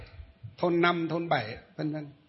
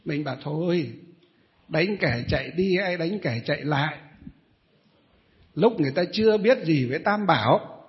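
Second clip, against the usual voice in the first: usual speaking voice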